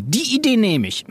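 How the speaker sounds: high, delighted acceptance; exclaiming. A narrator's reading. Teacher taking the pupil's idea, high exclamation. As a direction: call it out high with approval. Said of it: "rief sie".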